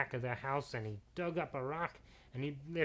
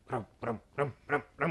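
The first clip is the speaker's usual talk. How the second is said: louder, rumbling imitated hoofbeats; thundering hoofbeats; 6 dB louder than usual. Narrator imitating knights' horses thundering past. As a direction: drum out galloping horses with your voice. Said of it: "they heard a thundering brum".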